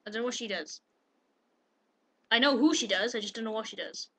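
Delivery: ordinary delivery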